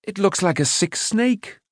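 high-pitched rueful complaint. A narrator's reading. Boy judging his own failed work, rueful. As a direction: lift the pitch, ruefully disappointed. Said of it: "he added ruefully".

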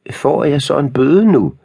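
put-on high, piping old-lady voice; whimpering squeak. A narrator's reading. Old lady worried about a library fine, high squeak. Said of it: "peb den gamle dame".

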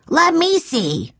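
high, drawn-out storybook shout; eager shout. A narrator's reading. Benny wanting a turn at the woodshed door, shouting. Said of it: "shouted Benny".

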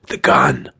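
low, strained cough of words; choked-out urgent command. A narrator's reading. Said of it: "I coughed".

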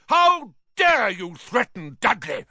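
high, furious roar. A narrator's reading. Uncle defending his son from a threat, roaring. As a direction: roar with outrage. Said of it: "roared Uncle Vernon".

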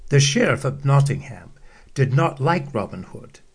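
usual speaking voice

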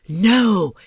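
loud, sharp "No"; shout. Acted. Jasper stops Simba eating the mouse, loud shout. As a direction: shout him down at once. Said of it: "Jasper shouted".

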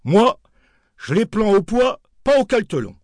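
a wholesaler laying down his terms; dealer's voice. Retold or imitated. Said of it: "précisant".

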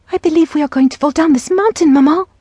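high, fast, fearful plea to mother; timid child panicking at the drop; frightened young girl. A narrator's reading. Timid sister frightened in carriage.

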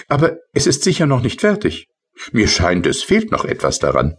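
high, light little sprite voice; tiny rain sprite. Acted. Tipsel's voice in story. (hohe, leichte Koboldstimme)

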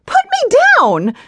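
high, loud panicked cry; frightened cry. Acted. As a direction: cry out in fear and humiliation. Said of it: "She cried out in fear".

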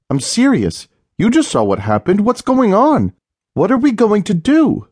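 insisting to her dismissive husband; wife frantic, near shouting; panicked insistence. A narrator's reading. Leah insists it is real.

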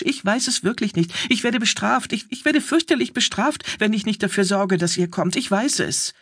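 high-pitched, frightened begging; pleading. A narrator's reading. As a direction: beg in rising panic, stammering a little. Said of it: "flehte der Bursch". (flehend und ängstlich, mit hoher Stimme)

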